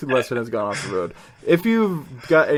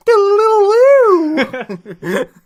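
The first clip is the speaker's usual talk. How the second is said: very high, loud imitated wail; rock riff noise; 12 dB louder than usual. Host showing another rock monster, loud high squeal. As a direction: squeal out a mock rock lick.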